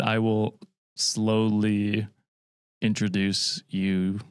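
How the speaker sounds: drawn-out slow introduction; deliberately slow speech. Shown as a demonstration. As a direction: stretch every word out slowly. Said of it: "Now I'm doing it very slow".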